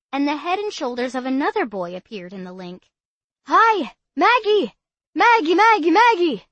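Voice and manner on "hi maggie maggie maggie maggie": high, loud, overjoyed calling out; excited greeting. Acted. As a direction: gush with delight at seeing her. Bobby greets Maggie excitedly.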